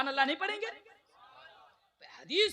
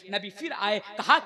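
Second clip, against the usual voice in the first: ordinary talk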